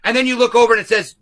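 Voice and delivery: ordinary delivery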